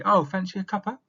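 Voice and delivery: high, loud, chirpy "Fancy a cuppa?"; offering tea. Shown as a demonstration. Teacher acting a British tea offer, high and loud. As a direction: offer a friend tea brightly. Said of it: "you might say".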